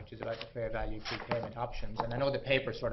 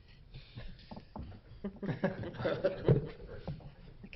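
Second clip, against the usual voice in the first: breathy little laugh; 9 semitones higher than usual. Real, not acted. Speaker laughs after asking.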